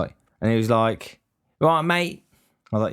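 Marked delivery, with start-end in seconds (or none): 1.6-2.2 high, lightly hammed Cockney market-trader greeting; mock a young Cockney stallholder chirping hello; a hint of a Cockney trader; Cockney marketeer. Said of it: "talking like a forty-year-old Cockney marketeer".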